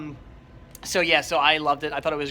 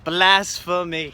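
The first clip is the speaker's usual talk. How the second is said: slow mock outrage; 5 dB louder, much slower than usual. Mock outrage, repeated slowly.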